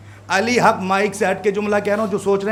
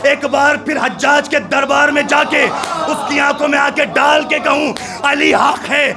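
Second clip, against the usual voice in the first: preaching at full force; 4 semitones higher than usual. Acted. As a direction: preach it, rising to a shout.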